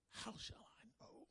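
hushed, breathless whisper of doubt. Acted. Abraham quietly questioning God, whispered. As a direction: whisper it as if afraid to ask.

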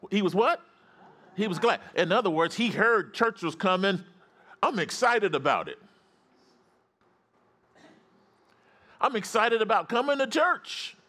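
high, emphatic sermon delivery; fired-up preaching. Real, not acted. Pastor urging congregation to be glad about church, fired-up preaching. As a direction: preach it high with stretched key words. Preacher rises to full force.

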